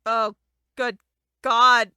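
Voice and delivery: loud shaken gasp of God; startled and rattled, for real; real fright. Real, not acted. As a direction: cry it out after a scare. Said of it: "so scared".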